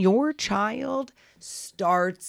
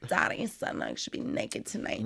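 breathy Megan Fox imitation; 10 semitones lower, 11 dB quieter, slower than usual. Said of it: "she was like".

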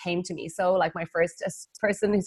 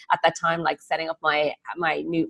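normal talking voice

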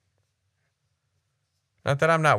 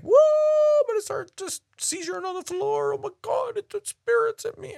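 much higher, squealing hammed-up ecstasy; mock charismatic worshipper. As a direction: parody someone seized by the spirit.

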